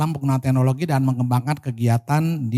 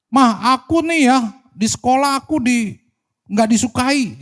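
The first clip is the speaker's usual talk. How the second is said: put-on complaining child; 10 semitones higher than usual.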